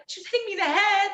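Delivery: high-pitched imitated complaint; mock alarm. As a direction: act out a frantic protest to teammates. Retells exhausted fighter's alarmed complaint.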